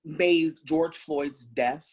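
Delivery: ordinary talk, nothing marked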